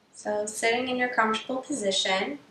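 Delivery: soft, unhurried meditation voice with long pauses; hushed, even-toned meditation leading; guided meditation. Acted. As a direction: gently guide them into the breath, leaving space between phrases.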